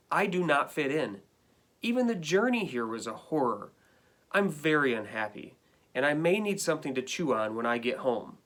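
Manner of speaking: lively read-aloud of a dog's complaining letter; reading to children. Acted. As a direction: read it to the class like a hard-done-by pet. Teacher reads dog's letters aloud.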